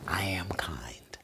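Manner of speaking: slow, soft whispered vow. Shown as a demonstration. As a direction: whisper it slowly and sincerely. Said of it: "you wanna speak slow".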